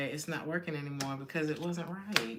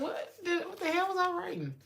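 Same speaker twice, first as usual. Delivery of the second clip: high, baffled what the hell; startled at her own old note; mock surprise; 12 semitones higher than usual. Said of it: "I'm like".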